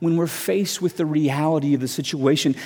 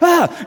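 short, high mock scream; startled scream; 12 semitones higher, 4 dB louder than usual. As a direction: shriek as if something jumps at you. Raccoon comes at him.

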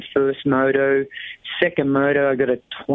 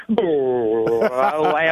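normal talking voice

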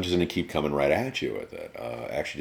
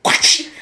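whoosh sound effect; 12 dB louder than usual. Pretend. Mimics blade springing out.

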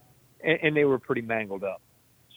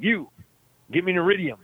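high, imperious call-out, acted out; mock commanding, pitched up on the summons; retold bossy order; 7 semitones higher than usual. Patient ordering some bystander to fetch a phone, retold bossy order.

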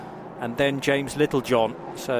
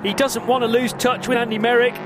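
raised-pitch race call; excited commentary; 7 semitones higher than usual. Real, not acted. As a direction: build up the overtake excitedly. Overtaking battle call.

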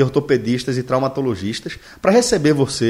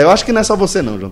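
normal talking voice

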